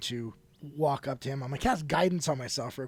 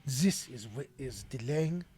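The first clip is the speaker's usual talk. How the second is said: calming voice; 3 semitones lower, 8 dB quieter than usual. Acted. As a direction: gently reason with him. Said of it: "And I, again".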